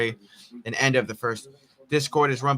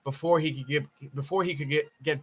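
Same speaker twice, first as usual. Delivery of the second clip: stuttering, repeating the phrase; stumbling over "before he could get"; 7 dB quieter than usual. Real, not acted. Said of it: "I'm stuttering".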